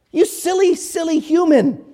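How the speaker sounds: high, condescending mock scolding; angelic attitude. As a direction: look down on the foolish human, pitched high. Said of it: "they're just oozing with attitude".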